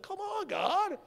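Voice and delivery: pitched well up, pleading complaint; mock whiny protest to God. Pastor joking he would protest to God in heaven, mock whine. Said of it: "I'd go to heaven and be like".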